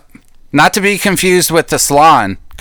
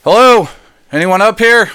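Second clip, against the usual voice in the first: louder, higher hail up the tower; calling out; 8 semitones higher, 4 dB louder than usual. Acted. Cowboy calling up an empty tower to find the general, loud and high. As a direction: call out to see if anyone's there.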